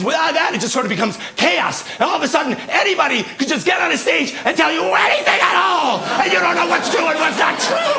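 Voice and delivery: rising to a high, strained shout; building impassioned rant. Real, not acted. Comic warns the audience about lost truth, building impassioned shout. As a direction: climb from argument into a full-voiced cry.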